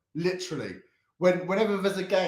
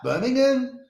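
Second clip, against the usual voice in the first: Birmingham? with a higher, Brummie-style lilt; mock Birmingham accent; 10 semitones higher, 5 dB louder than usual. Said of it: "They talk like that?".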